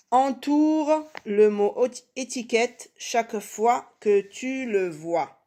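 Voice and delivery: slow, high, evenly spaced words; slow instruction reading. Shown as a demonstration. Teacher reading the exercise to pupils, slow instruction reading. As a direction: read it out deliberately, word by word.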